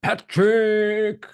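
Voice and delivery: stretched-out fighter introduction; ring announcer. Acted. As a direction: hold the name long, first name high and loud.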